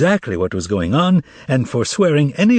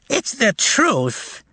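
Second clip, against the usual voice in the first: put-on high, slow childlike cry; thin childlike shout; 10 semitones higher, much slower than usual. Old man siding with the crowd, high and childlike. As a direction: squeak it out in fury. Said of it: "in a thin, childlike voice".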